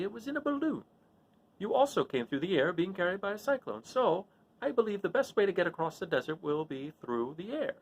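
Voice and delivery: a fussy little man thinking aloud; the little wizard talking Dorothy through his plan. Acted.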